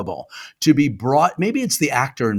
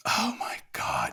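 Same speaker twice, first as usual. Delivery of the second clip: slow, hushed mock-awed whisper; 10 semitones lower, 11 dB quieter than usual. Guest reacting to the host's restaurant question, awed whisper. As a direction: whisper it slowly in exaggerated awe.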